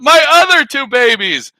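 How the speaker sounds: high, loud wailed retort; mock demanding mother. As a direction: imitate a mother protesting in an argument. Imitated mother answers back.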